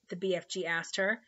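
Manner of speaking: usual speaking voice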